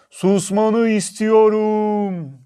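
high, loud, very slow echoing call; mountain echo. A narrator's reading. Mountain repeats the girl's demand, long loud echo. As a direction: boom it back with long stretched vowels. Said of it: "Karşıki dağdan bir ses geldi".

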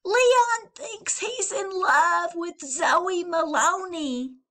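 slow, high, loud tease; tattling Valentine. Acted. Valentine to the girls, slow high tattle. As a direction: sing out the secret.

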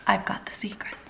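conspiratorial whisper to the camera; secret whisper. Acted. Telling viewers she has dating secrets, whispered.

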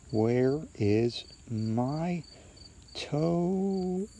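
very slow, drawn-out spooky groan; eerie voice calling from the dark; groaning ghostly voice. A narrator's reading. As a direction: groan it out, rising high on the last word. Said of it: "it groaned".

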